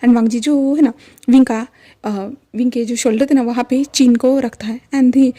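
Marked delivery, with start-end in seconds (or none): none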